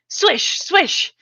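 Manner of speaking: high whooshing swish noises, falling; sword swish sounds. A narrator's reading. Girl playing with a stick, voicing sword swishes. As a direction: swoosh the stick through the air.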